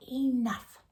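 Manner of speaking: ordinary delivery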